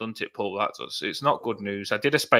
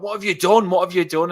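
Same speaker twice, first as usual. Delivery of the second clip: imitating a panicked mate, pitched up and louder; retold panic; 7 semitones higher, 7 dB louder than usual. Mate reacting to friend's one phone call, imitated panic. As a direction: blurt it out as the alarmed friend. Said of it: "he was panicking and everything".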